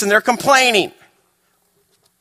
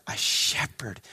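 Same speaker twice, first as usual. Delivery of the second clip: a shepherd whispered, much softer; 10 semitones lower, 13 dB quieter, slower than usual. Real, not acted. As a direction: breathe a shepherd as a secret. Repeated phrase whispered.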